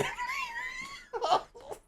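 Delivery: very high wheezing laugh with a shout; dissolving into laughter; shrieking laugh. Real, not acted. Laughing at his own story.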